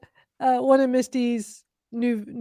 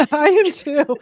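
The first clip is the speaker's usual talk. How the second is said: crying laughing; 5 semitones higher, 8 dB louder than usual. Real, not acted. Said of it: "Sorry, I'm crying".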